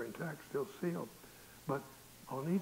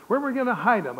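loud, high retold question; acting out a boy's urgent worry; 9 semitones higher, 12 dB louder than usual. Boys needing to hide their cigarettes, urgent and loud.

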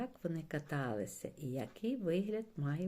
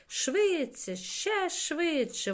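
very high, stretched-out urging; urgent yell; 11 semitones higher, 8 dB louder, much slower than usual. A narrator's reading. Чорник yells to his water strider, urgent cry. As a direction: urge the mount on, pitch way up. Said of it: "волав чорник водомірці".

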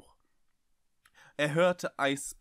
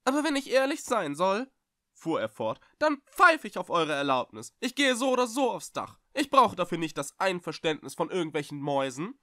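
much higher and louder, cheeky confidence; louder cheeky bravado, much higher; brash, self-assured; 7 semitones higher, 6 dB louder than usual. Acted. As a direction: put on a bold front to hide the fear. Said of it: "Er entschied sich für ein forsches, selbstbewusstes Auftreten".

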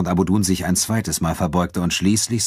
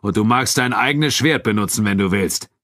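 raised, higher challenger's voice; Abu Dun; 4 semitones higher, 3 dB louder than usual. A narrator's reading. Nubian fighter offers his friend a wager, proclaiming. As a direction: tempt him with a public wager. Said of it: "sagte Abu Dun".

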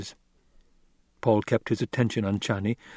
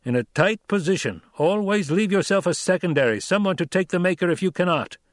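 raised, firm voice of a stern desert chief; Stilgar, stern Fremen leader; 7 semitones higher than usual. A narrator's reading. Stilgar rebuking Paul after his sloppy ride, stern. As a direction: scold the young rider with authority.

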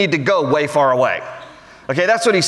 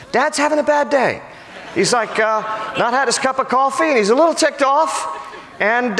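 much higher, comic whiny son; high, rueful son griping about his dad; exasperated son; 8 semitones higher than usual. Pretend. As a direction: play Jonathan as a fed-up kid. Jonathan voiced as modern son.

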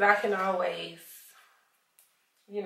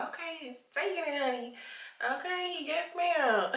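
lightly voiced, higher sassy quote; imitating influencer; 8 semitones higher, 3 dB quieter than usual. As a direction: mimic her catchphrase, a bit higher. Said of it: "she always be like".

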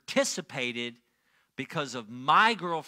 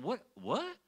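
short, rising, softer whats; bewildered questioning; 4 semitones higher, 5 dB quieter than usual. Retold or imitated. Man confused at what he is saying, bewildered questioning. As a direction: question your own words in disbelief. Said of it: "I'm saying it and saying".

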